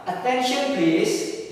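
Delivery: slow, drawn-out "Attention please" with a high start; teacher's slow drawn-out demo. Shown as a demonstration. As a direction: model "Attention please" slowly for the class. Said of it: "Contoh-contoh ekspresinya ketika meminta perhatian".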